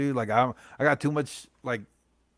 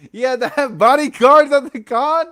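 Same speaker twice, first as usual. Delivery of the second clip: loud, high burst of gossip; gleeful disbelief; 11 semitones higher, 13 dB louder than usual. Real, not acted. Telling his chat the gossip, loud and gleeful. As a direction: blurt it out, delighted by the drama.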